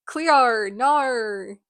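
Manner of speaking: hammed-up Australian vowels; Australian accent. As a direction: put on a bad Australian accent. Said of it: "I'm Australian".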